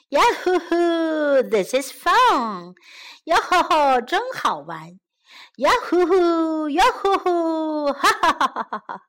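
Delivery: whooping, laughing storybook frog; gleeful frog. Acted. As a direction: whoop playfully through a swim. Frog whoops while playing.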